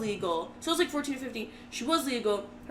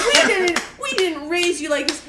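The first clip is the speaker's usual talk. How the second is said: hammed-up scolding mother, higher and louder; Meryl Streep; 4 semitones higher, 4 dB louder than usual. Mom scolding daughter before the Mounties, theatrical mock outrage. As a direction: overplay the disappointed parent for an audience. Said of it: "pretending to be Meryl Streep".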